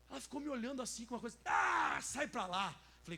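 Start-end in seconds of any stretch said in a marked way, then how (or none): 1.4-2.8 annoyed shout. Retold or imitated. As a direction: shove him away with your voice. Relative shouts at pastor. (grito irritado, alto)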